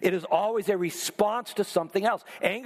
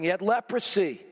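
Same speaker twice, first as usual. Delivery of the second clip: normal talking voice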